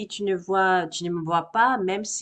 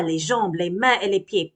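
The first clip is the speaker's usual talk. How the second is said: usual speaking voice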